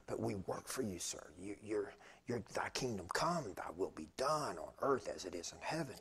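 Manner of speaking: soft, low, reverent praying; hushed recollection of a tearful prayer; retold tearful prayer. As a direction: pray it quietly, as if remembering tears. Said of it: "And I'm crying".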